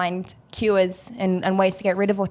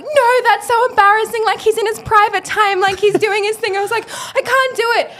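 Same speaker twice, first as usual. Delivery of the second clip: high, flustered retold protest; panicky; 14 semitones higher, 4 dB louder than usual. Nervous fan refusing to approach her idol, high flustered voice. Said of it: "I'm like".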